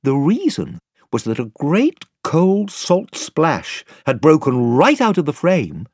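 ordinary delivery

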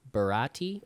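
"Barati" as buh-RAH-tee; alternative pronunciation. Shown as a demonstration. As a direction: stress the middle of "Barati", with an ah. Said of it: "I don't know how to say it".

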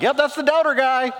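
high, knowing mock voice; high snide voice; mocking churchgoer. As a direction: sneer it like everyone knows. Congregation's mocking reaction.

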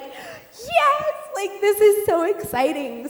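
high, loud, excited voice; excited gush. Retold or imitated. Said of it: "And I'm like".

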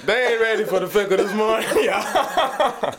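laughing through the words; cracking up while announcing. Real, not acted. Radio host cracking up at the cohost's rant, laughing.

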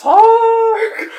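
booming sea-curse cry; high, very loud mock incantation; Willem Dafoe. Imitating the Neptunian sea curse, hammed-up bellow. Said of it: "Willem Dafoe, like, Neptunian, like, sea curse".

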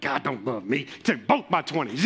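sulky grumble. Retold or imitated. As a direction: grumble it like a sulking young man. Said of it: "and I'm like".

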